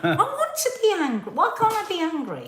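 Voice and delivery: high, laughing indignation; laughing protest. Real, not acted. Laughing protest about being angry.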